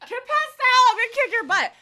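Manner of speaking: loud high mock threat; playful yell.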